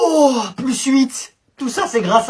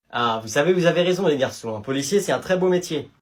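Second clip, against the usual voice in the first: policeman voice; 7 semitones lower, 4 dB quieter than usual. Acted. Policeman answers kids.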